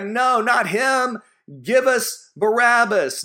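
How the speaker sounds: high, loud crowd yell; crowd shouting. Acted. Preacher voicing the crowd shouting back at Pilate. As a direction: shout it out as the angry mob. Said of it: "They shouted back".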